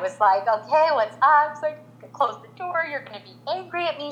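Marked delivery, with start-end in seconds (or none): 0.5-1.5 higher-pitched mock mom reply; lightly acted, raised-pitch question; retold concerned mom. Mom answering her dramatic five-year-old, retold concern. 2.1-4.1 put-on high, softening little-girl plea; retold upset child. Five-year-old confiding in her mom, imitated upset. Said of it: "She's now crying".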